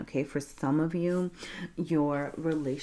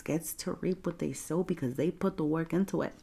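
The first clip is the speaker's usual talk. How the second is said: ordinary delivery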